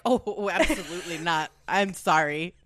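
a hint of a flustered bear backing off; mock-polite bear voice, half laughing; polite retreating bear. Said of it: "the grizzly bear was like".

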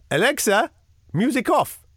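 high-pitched mimicked voice command; sing-out command, clearly separated; demonstrating Alexa. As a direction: imitate someone showing off a smart speaker. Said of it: "to demonstrate Alexa to me".

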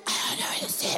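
small unvoiced whisper of a scared kid; mock timid kid whispering. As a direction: whisper it, lost and meek. Kid self whispering in story.

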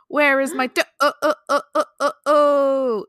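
hammed-up spooky groan; groaning ghost. A narrator's reading. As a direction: wail like a campfire ghost, long stuttered last vowel. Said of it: "it groaned".

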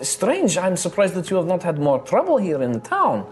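high, friendly tavern hostess; bubbly innkeeper charming her guests; cheerful halfling innkeeper. Acted. GM voices innkeeper Rosalina.

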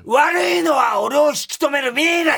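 high, loud frantic ranting; frantic shout. Acted. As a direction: yell it like a lovesick tantrum. Reads ranting letter in outburst. (半泣きで叫ぶ)

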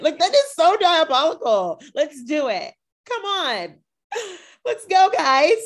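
very high, loud squealing enthusiasm; excited. Real, not acted. Host cheering on the sisterhood drama, excited. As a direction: egg them on, thrilled by the scheming. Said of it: "I'm really excited to watch her".

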